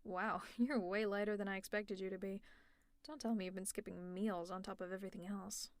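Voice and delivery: ordinary talk, nothing marked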